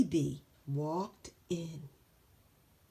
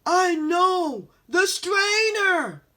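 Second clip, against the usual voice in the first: excited; 11 semitones higher, 10 dB louder, slower than usual. Acted. Excited idea.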